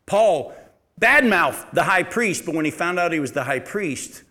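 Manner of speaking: ordinary delivery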